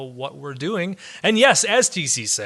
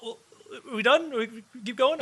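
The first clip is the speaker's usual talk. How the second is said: mock surprise; 9 semitones higher than usual. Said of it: "I'm like".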